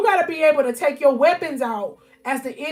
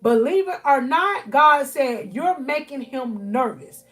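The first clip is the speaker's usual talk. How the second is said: louder, higher, building sermon cadence; full-force preaching; 4 semitones higher, 4 dB louder than usual. Acted. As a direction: preach it hard to the congregation.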